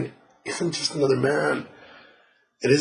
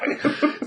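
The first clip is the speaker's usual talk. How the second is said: breathy laugh; 14 semitones higher than usual. Real, not acted. Laugh after the mock scream.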